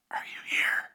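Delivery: unvoiced spooky whisper; creepy whisper. Brother whispering to his sleeping sister from under the bed, creepy whisper. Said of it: "hiding under her bed and whispering".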